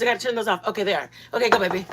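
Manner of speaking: ordinary talk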